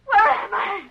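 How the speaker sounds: high, tearful panicked question; panicked crying. Acted. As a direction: sob it out in panic. Tied up, panicking.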